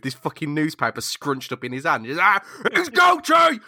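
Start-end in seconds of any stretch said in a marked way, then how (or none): 2.2-3.6 much higher, slow, hammed-up screaming; raging shriek. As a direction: shriek the name like a furious old editor. JJ raging at newspaper.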